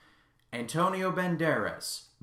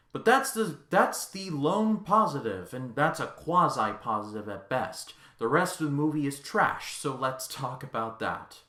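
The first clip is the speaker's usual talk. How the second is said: ordinary talk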